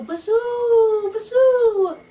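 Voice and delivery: very high, loud, drawn-out wind howl. Acted. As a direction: moan like a gale through the trees. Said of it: "The wind blew".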